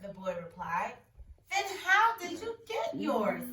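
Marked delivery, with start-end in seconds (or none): 1.5-3.4 sharp, higher, louder snap; snapping. Acted. King snapping at the boy, higher and louder. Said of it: "snapped the king".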